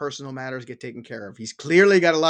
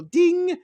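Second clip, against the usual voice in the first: high sung ding like a notification bell; 12 semitones higher, 4 dB louder than usual. Pretend. Host miming the notification bell for viewers, high ding. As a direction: chime it out like a phone alert.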